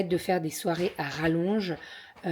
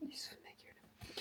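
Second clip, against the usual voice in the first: whispered aside; 26 dB quieter than usual. Real, not acted. Aside about partner teasing her.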